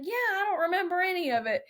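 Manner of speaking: high-pitched imitation of mock grumbling; retold joking gripe from an old man; teasing complaint. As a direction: give them playful trouble, voice lifted high. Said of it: "he was giving him so much trouble".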